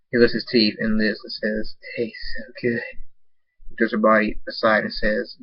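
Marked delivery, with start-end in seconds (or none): 2.0-2.8 soft, relishing whisper; savouring whisper. Acted. Nightstorm after the bite, whispering with relish.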